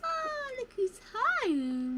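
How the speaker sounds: high, slow, sing-song welcome home; welcoming someone home. Acted. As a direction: coo it like greeting a beloved pet. Greeting someone arriving home.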